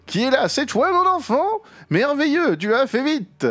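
put-on high, eager old grandpa greeting his grandchild; high voice of an enthusiastic old inventor. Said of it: "C'est Papy!".

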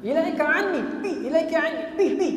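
high, insistent order to go; urgent dismissal. Retold or imitated. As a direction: drive them off in repeated bursts. Scholar sends the woman away.

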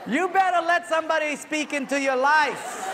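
high, loud preacher's exhortation; emphatic shout. Real, not acted. Preacher driving home the joke's lesson, loud shout. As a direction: belt out the moral over the laughter.

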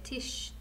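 "Tish" whispered, ending on a long soft sh; sh sound shown. Shown as a demonstration. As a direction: breathe out "Tish" to show the sh. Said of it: "It sounds like there's a sh".

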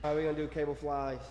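normal talking voice

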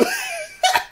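real high squealing laugh; squeaky burst of laughter. Real, not acted. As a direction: let the laugh jump way up. Laughing at the question.

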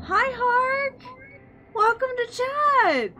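high, bubbly streamer voice greeting chat; high cutesy greeting. Acted. Streamer greeting chat members, high cutesy voice. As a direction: squeal hello to the chat, slow and sweet.

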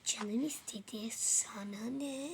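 slow whispered cheeky reply; sulky whisper, backtalking; slow whispered sass. Acted. Voicing the cheeky girl's reply.

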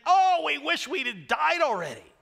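high, loud whiny mock lament; mock moaning Israelites; mocking complainers. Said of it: "they're basically saying".

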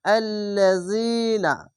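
আল্লাযিনা said slowly, the long vowels held; teacher modelling the long vowel; drawn-out vowel. Shown as a demonstration. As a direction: stretch আল্লাযিনা with one alif length. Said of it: "এক আলিফ টেনে পড়বেন".

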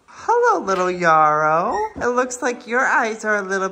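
very high, loud delighted hello; excited squeal. Real, not acted. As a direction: squeal a greeting to the pup. Squealing greeting to puppy.

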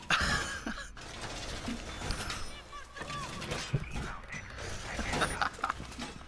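wheezing laugh. Real, not acted. Long laugh fit.

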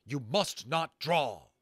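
slow, loud royal command; thundering command. A narrator's reading. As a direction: hold them back with authority. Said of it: "His voice was thunder".

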